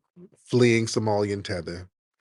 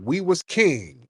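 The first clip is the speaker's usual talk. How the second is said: slow, drawn-out, higher mock quote; 4 semitones higher, slower than usual. Host mimicking another caller's catchphrase, drawn-out mockery. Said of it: "we got another one".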